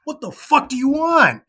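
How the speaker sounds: loud, high-pitched reenacted outburst; startled angry shout. Startled rock star turning on a fan, angry shout. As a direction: shout back as if scared out of your skin.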